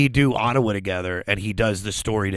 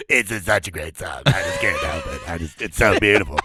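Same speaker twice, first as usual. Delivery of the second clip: a hint of a gruff pirate, lower; 4 semitones lower than usual. Comedian mock-crying to moms, light pirate voice. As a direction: lightly play a teary pirate. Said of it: "with my pirate voice".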